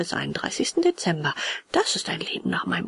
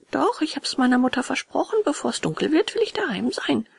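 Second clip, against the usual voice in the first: put-on resolute puppet boy; 6 semitones higher than usual. A narrator's reading. Puppet refuses friend, promised his fairy mother, high resolute voice.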